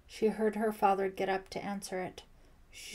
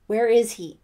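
very loud, forceful question; demanding; 2 semitones higher, 14 dB louder than usual. A narrator's reading. Lieutenant Wilkins hunting for Hawkins, demanding. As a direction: fire the question out with authority. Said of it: "he demanded".

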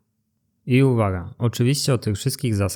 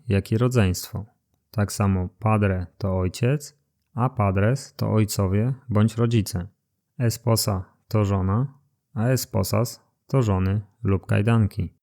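ordinary delivery

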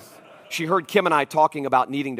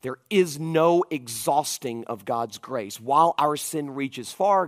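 ordinary talk, nothing marked

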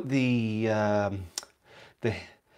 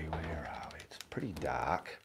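breathy, voiceless whisper; 6 semitones lower, 12 dB quieter than usual. Pretend. Showing viewers into a dark cupboard, whispering. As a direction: whisper it like exploring somewhere dark.

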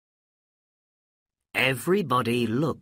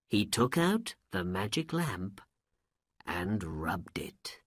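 ordinary delivery

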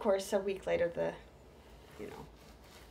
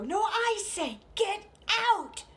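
very high, loud mock shout; 13 semitones higher, 7 dB louder, slower than usual. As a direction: mom yelling back, hammed-up. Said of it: "my mom's sweet voice go".

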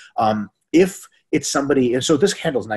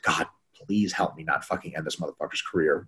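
muttered prayer; 6 semitones lower, 7 dB quieter than usual. Retold or imitated. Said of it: "I was like".

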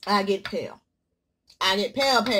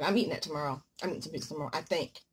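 quiet inner voice; 7 semitones lower, 7 dB quieter, faster than usual. Retold or imitated. Quoting own thought softly.

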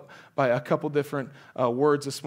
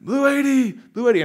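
high, loud barked call; quarterback cadence; 10 semitones higher, 8 dB louder than usual. Retold or imitated. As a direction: call the snap count like a quarterback. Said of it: "He's like".

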